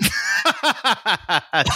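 long run of high laughter. Real, not acted. Co-host laughing at the host's disbelief, laughter. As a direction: burst out laughing at the reaction.

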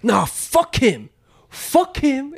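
high, slow scornful dismissal; defiant coworker. Retold or imitated. Coworker telling the younger guy to ignore the old man. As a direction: wave him off with contempt. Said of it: "He's like".

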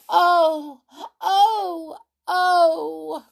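high, loud drawn-out groaning; hammed-up moaning "oh"s; put-on sick groans. A narrator's reading. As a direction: groan loudly as if pretending to be ill. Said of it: "groaned Binkle in bed".

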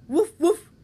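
two loud, high barks; woof woof. Acted. Bird in the tree looks down, loud barking. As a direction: bark twice like a dog. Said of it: "it said, and flew off".

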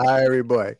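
hammed-up Jamaican accent on cue; loud playful Jamaican patois greeting. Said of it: "the Jamaican accent, that one I can do".

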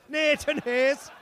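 high, slow put-on Irish lilt; mock Irish accent. Co-host joining in on the Nathan joke, high mock Irish. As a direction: echo it in a high stage Irish. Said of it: "That's a good Irish".

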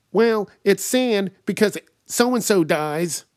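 loud, high, slow mimicry; high-pitched mocking caricature; obnoxious spoiler guy. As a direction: blurt it out as an oblivious loudmouth.

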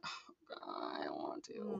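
very low, soft mock groan; dreading groan. Student reacting to the invite for evening prayer, dreading groan. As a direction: groan it under your breath with dread.

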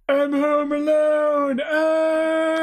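high, slow, mock wail; hammed-up high wail. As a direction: camp it up with a sung high cry. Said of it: "Jordan goes".

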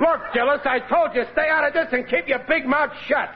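jealous husband snapping at his pal; angry outburst. Acted. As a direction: tell him to shut up, fuming. Riley snaps at his friend.

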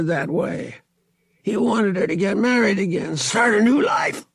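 high, strained, insistent voice; pressured cousin Thraken. Acted. Cousin pinned, pressed for answers.

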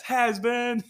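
jeering from the sideline at a bad pass; retold heckling yell. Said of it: "Did you hear me yelling at him".

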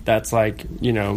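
ordinary delivery

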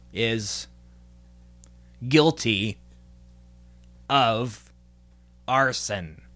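slow, low, halting robot voice; robot voice, slow and spaced out. Pretend. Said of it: "Sorry I talked like a robot there".

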